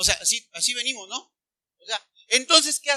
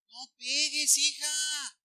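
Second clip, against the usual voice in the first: soft, drawn-out, higher chiding; mild mock scolding mother; 3 semitones higher, 6 dB quieter, much slower than usual. Mother to daughter who hits, mild chiding. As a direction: gently chide a child, stretching words.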